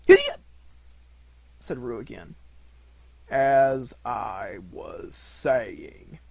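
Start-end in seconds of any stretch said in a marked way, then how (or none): 0.1-0.4 very high, loud squeaky cry; sudden shout. A narrator's reading. Little Roo interrupting Eeyore's speech, a sudden squeaky shout. 3.3-6.2 slow, stern and drawn out; stern Eeyore. A narrator's reading. Eeyore resuming his speech after interruptions, slow and stern. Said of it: "said Eeyore loudly and sternly".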